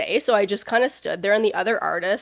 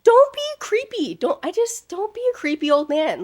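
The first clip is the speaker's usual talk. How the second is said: disgusted scolding; 10 semitones higher than usual. Retold or imitated. Scolding a creepy man.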